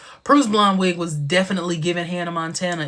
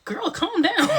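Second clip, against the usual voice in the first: laughing; 13 semitones higher, 3 dB louder than usual. Real, not acted. Laughing at herself.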